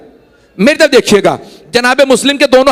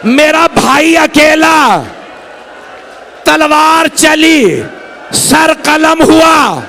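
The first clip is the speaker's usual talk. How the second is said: anguished wail; 4 semitones higher, slower than usual. Acted. Abbas crying to Husain as his brother is beheaded, anguished wail. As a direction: wail it out at full force, dragging every word.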